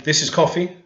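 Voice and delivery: "coffee" said up high with a rise; contrastive stress on coffee. Shown as a demonstration. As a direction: set up "coffee" as the first item. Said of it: "So we're saying".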